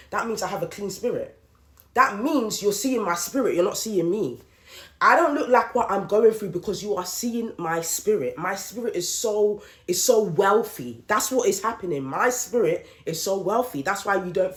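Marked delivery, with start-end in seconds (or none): none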